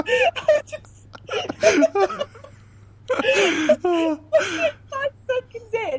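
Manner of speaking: high-pitched, laughing through the words; corpsing, barely getting the sentence out; helpless laughter. Real, not acted. Performer apologizing to co-star for cracking up, helpless laughter. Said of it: "pissing myself".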